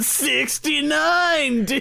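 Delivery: high, drawn-out dude-bro cheer; mock hype. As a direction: stretch it out in goofy excitement. Mock hype over the episode number.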